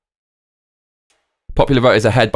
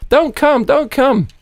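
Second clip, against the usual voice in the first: much higher, squeaky "don't come"; mock falsetto plea; 13 semitones higher, slower than usual. Reading chat quote mockingly.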